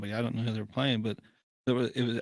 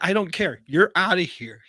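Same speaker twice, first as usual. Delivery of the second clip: loud, raised, dismissive ejection; imitated umpire yell; 7 semitones higher, 6 dB louder than usual. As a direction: play an umpire overruling everyone at higher pitch. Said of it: "and he yells".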